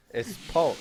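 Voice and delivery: imitated Chinese accent on "Pork"; put-on Chinese accent. Shown as a demonstration. Co-caster repeats the name to his partner, mock Chinese accent. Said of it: "I had to put in the accent".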